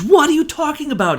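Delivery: high-pitched baffled question; hammed-up bewilderment; mock incredulity. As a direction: act out total confusion, voice jumping up. Acted baffled reply of Putin.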